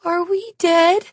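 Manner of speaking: put-on a frightened little boy asking; trembling child question; shaking child voice. A narrator's reading. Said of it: "his little voice shaking now".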